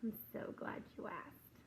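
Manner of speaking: whispered like a little schemer; student whispering her deal to the teacher; scheming whisper. Retold or imitated. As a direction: lean in and whisper the plan. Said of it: "And she goes".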